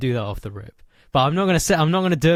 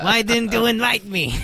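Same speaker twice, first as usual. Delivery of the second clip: high-pitched cartoon villain complaint; high, put-on aggrieved character voice; Waluigi; 10 semitones higher, 3 dB louder than usual. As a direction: whine it high like a left-out villain. Acting as Waluigi after his cry.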